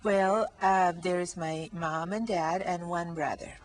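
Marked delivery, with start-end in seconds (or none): none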